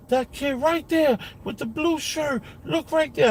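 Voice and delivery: put-on high kid voice pointing someone out; imitating his son, high and urgent; son's voice. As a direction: play the boy showing dad the culprit. Father voicing young son's words.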